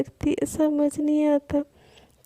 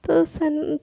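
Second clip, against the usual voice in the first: usual speaking voice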